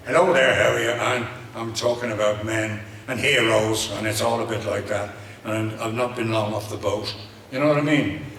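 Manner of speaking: lively, natural Irish brogue; fresh-off-the-boat Irish accent. Shown as a demonstration. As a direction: chat away in an Irish accent, punching the greeting. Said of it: "it's all a little bit with my Irish".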